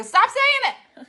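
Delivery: high, loud mock outburst; hammed-up exasperated yell; exasperated shout. Retold exasperated outburst at Grandma.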